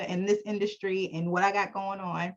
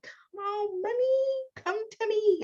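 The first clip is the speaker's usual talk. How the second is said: calling money; 13 semitones higher, slower than usual. Acted. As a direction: beckon money like a pet, high and sweet. Coaxing money during affirmations.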